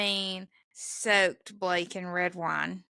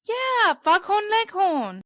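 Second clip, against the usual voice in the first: mock London teen; 12 semitones higher, 6 dB louder, slower than usual. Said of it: "And he was like".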